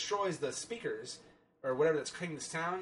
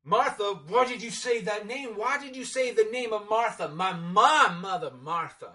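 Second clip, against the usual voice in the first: higher and louder hammed-up gasping Batman; mock Bruce outburst; 6 semitones higher, 8 dB louder than usual. Reviewer mocking Batman's Martha moment, hammed-up shocked shout. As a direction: overact the shocked Batman for laughs. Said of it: "when Bruce is like".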